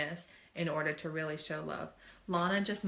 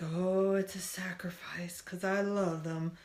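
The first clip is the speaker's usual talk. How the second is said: hammed-up self-sacrificing character voice; mock-noble, exaggerated put-on voice. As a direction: lay on a cartoonish martyr voice. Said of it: "Did you like that voice?".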